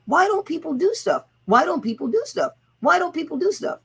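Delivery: high, repeated frustrated question; exasperated. Retold or imitated. Wondering aloud why people don't act, mock exasperation. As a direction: throw up your hands each time. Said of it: "sitting back here going".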